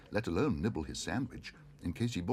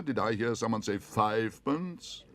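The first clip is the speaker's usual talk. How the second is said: incredulous auctioneer; 3 semitones higher, 4 dB louder than usual. A narrator's reading.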